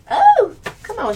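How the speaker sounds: high, loud come on; can't contain the thrill; excited squeal. Real, not acted. Thrilled by shower sex, high loud squeal.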